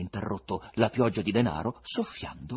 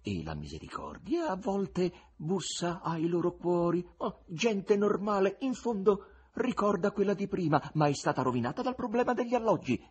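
musing Voland; 10 semitones higher than usual. Acted. Voland reflecting on Muscovites, lightly voiced. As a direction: reflect aloud.

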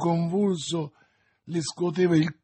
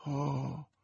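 horrified murmur; 8 dB quieter than usual. A narrator's reading. Athos staring at the glass in doubt, horrified murmur. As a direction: murmur it under the breath.

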